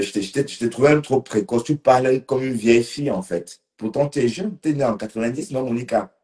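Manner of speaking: ordinary talk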